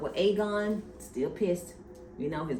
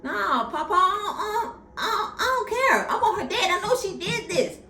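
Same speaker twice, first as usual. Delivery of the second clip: high, whining mock sob; high-pitched mock-tearful refusal with a laugh; whiny crying; 5 dB louder than usual. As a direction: whine like a crying spoiled son. Aegon voiced whining at papa.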